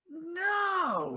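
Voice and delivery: sharp, high, loud imitated "No!"; imitating a calm man suddenly yelling no; upset shout. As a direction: bark the refusal like a shocked friend. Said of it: "He was just super upset".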